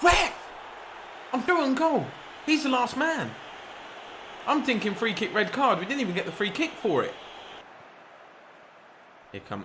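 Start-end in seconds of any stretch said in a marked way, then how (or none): 0.0-0.3 appeal to ref. Real, not acted. Gamer yelling at the referee for a foul, outraged. 1.3-7.1 much higher, indignant complaint; furious the ref missed it; outraged protest. Real, not acted. Gamer complaining to viewers about the referee, indignant.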